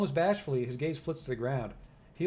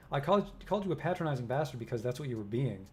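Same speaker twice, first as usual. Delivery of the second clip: ordinary talk, nothing marked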